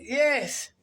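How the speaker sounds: ordinary delivery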